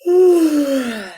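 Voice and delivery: loud high put-on yawn; big yawn. Reading the yawn book to a child, a huge yawn. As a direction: stretch out a huge theatrical yawn.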